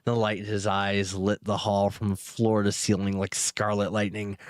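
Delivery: plain reading voice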